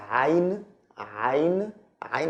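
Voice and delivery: throaty Arabic ain consonant; ain from the throat. Shown as a demonstration. Teacher showing a difficult Arabic letter, throaty ain. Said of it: "from the throat".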